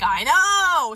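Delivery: loud, high, drawn-out agreement; freaking out. Retold or imitated. Agreeing with Max about the rumor, loud freaked-out cry. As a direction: wail it in exasperated agreement. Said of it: "So we're both freaking out".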